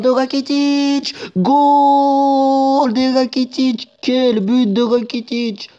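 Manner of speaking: long, drawn-out high goal shout; commentator goal call. Acted. Commentating his own goal, drawn-out high shout.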